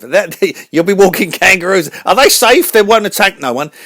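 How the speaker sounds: very high, loud cartoon host voice; radio host voice. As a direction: announce the show like a squeaky showman.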